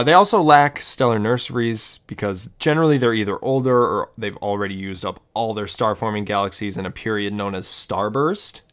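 ordinary talk, nothing marked

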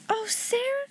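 high, soft cry of delight; joyful cry. A narrator's reading. Ermengarde seeing the decorated table, joyful cry. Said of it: "she cried out".

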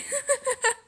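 a sudden high laugh caught on the edge of tears; tearful high laugh. Real, not acted. Venting to listeners about her dad, a tearful high laugh. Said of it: "I'm about to cry".

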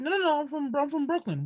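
high, insistent "no, no, no"; indignant protest. Retold or imitated. Retelling to friends, protesting I'm from Brooklyn, high and insistent. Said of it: "and they're like".

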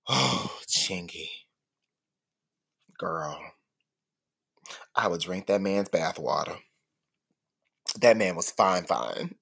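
low, soft swoon. Real, not acted. Host swooning over a fine rapper, low and hushed. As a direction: sigh it out, lovestruck and hushed.

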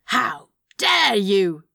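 slow, theatrical outrage; scandalised gasp. A narrator's reading. Demon clutching at pearls, theatrically scandalised. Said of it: "Crowley gasped, and clutched at pearls".

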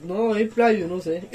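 ordinary talk, nothing marked

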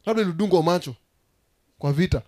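ordinary talk

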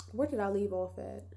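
ordinary talk, nothing marked

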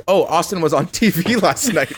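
announcing professor. Retold or imitated. Professor retold announcing it to class.

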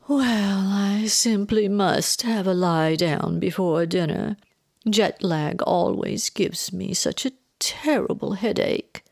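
slow, drawn-out theatrical weariness; swooning. A narrator's reading. Mother pleading jet lag before dinner, swooning. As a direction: swoon it out, feigning exhaustion. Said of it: "Vivian swooned".

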